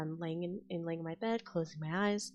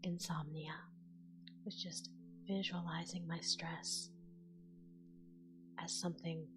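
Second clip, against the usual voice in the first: whispered guided relaxation; 10 dB quieter than usual. Acted.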